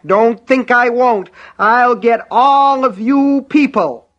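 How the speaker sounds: high, slow shrieking threat; screaming threat. Acted. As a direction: shriek it slowly, unhinged. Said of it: "Buster screamed back".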